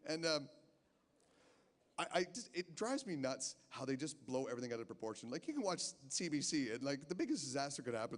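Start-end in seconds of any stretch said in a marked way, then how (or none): none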